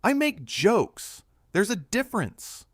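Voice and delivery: loud, high, exasperated insistence; booming. A narrator's reading. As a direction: proclaim it forcefully, pitch way up. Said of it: "Jeremy's voice boomed across the studio".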